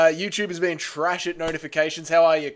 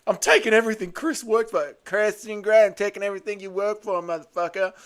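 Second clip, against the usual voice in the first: lightly put-on, higher-pitched voice; slightly raised mock-threatening voice; attempted impersonation; 5 semitones higher than usual. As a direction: have a go at mimicking someone's threat. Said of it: "I actually tried this time".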